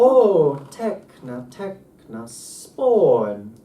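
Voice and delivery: loud swooping OH and SPAWN, lighter TEKNA between; pitch-accent recitation. Shown as a demonstration. Teacher reads the Greek line aloud, pitch-accent recitation. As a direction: chant it slowly, gliding on OH and SPAWN. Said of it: "So, so we go".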